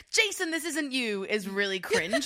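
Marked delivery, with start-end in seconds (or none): 0.1-1.3 higher-pitched mock plea; put-on pleading, pitched up; mock earnest plea. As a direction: imitate a cringey earnest intervention. Mock cringey pick-me quote.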